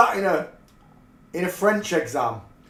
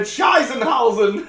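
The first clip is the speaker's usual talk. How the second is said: laughing quote; 7 semitones higher, 4 dB louder, slower than usual. Retold or imitated. Quoting the German lad's swear.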